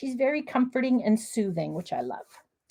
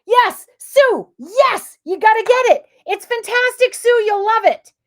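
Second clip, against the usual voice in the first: higher, louder, eager urging to buy; excited encouragement; 8 semitones higher, 8 dB louder than usual. Real, not acted. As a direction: gush to Sue that she'll love it. Excited reply to viewer Sue.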